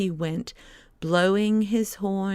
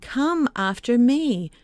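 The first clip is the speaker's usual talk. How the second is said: high, loud summoning shout; boy shouting for followers; 6 semitones higher, 5 dB louder than usual. A narrator's reading. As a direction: call out across the field. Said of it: "shouting and calling".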